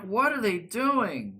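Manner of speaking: high, drawn-out exasperated question; baffled reaction. Retold or imitated. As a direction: stretch it out loud and high, totally bewildered. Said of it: "I'm like".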